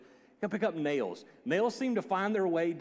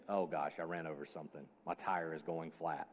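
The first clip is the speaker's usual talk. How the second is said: sinking dismay; 10 semitones lower, 9 dB quieter than usual. Retold or imitated. Inner dismay at hissing tire.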